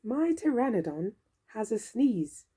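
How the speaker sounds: usual speaking voice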